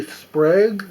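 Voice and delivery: ordinary delivery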